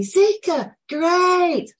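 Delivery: high, loud echo of the greeting; excited echo. Retold or imitated. The boy calling the greeting back, high and loud. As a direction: copy the playful call back. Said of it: "he did it back to me".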